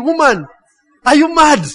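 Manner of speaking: high, incredulous exclamation; mock outrage. Husband thinking at his wife, mock incredulous outrage. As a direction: exclaim as an appalled husband, played up. Husband's shocked reaction, acted.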